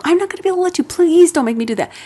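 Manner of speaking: put-on high, begging plea to the child; pleading parent. Shown as a demonstration. Parent pleading with a child the wrong way, whiny. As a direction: beg the kid not to make you do it. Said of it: "Don't say that to kids".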